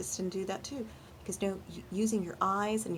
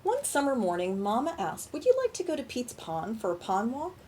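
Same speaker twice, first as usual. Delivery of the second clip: put-on bright, louder read-aloud for kids; storybook reading; 4 semitones higher, 5 dB louder than usual. A narrator's reading. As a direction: read it like a children's story hour. Reads picture book to children.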